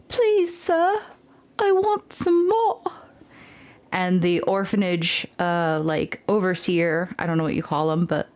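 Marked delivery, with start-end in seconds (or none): 0.1-2.8 put-on much higher small pleading child, slow; Oliver Twist. The orphan pleading with the overseer, childlike begging. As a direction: beg meekly like an orphan. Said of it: "where he goes".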